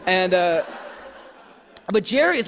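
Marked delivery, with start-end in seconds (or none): none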